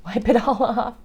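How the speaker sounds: ordinary delivery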